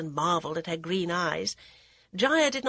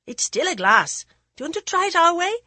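put-on daring village boy; 11 semitones higher, 7 dB louder, faster than usual. Boy dares Jaya.

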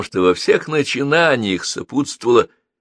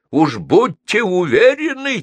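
impatient bark; 10 semitones higher, 4 dB louder than usual. A narrator's reading. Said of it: "теряя терпение, гаркнула бабушка".

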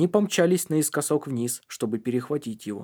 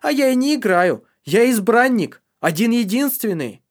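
manic insistence; 10 semitones higher, 7 dB louder than usual. Acted. Boy insists he is chosen.

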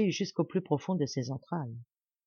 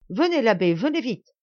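louder, higher, pressing call; urgent urging; 4 semitones higher, 6 dB louder than usual. A narrator's reading. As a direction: hurry him out the door. Baron urges the priest to hurry.

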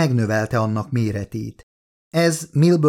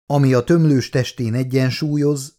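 ordinary delivery, nothing marked

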